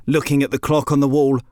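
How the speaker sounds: usual speaking voice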